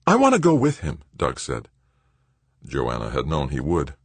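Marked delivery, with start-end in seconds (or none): 0.1-1.0 high, loud, eager declaration; keen son, voice raised high; burning with enthusiasm. A narrator's reading. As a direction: insist on joining the adventure. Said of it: "burning with enthusiasm".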